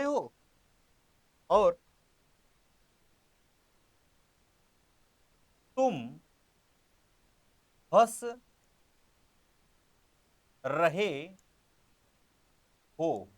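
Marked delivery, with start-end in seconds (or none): 1.5-13.3 1 word-by-word dictation. Shown as a demonstration. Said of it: "dictating word by word".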